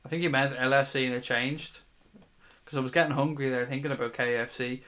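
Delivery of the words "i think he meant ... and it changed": ordinary talk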